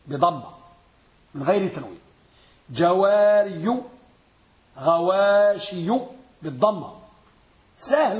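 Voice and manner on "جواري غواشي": each word stretched out slowly for the class; drawn-out teacher demo of the original forms. Shown as a demonstration. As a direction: spell out جواري and غواشي syllable by syllable, slowly. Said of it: "ركزوا معي في أصل جوار وغواش".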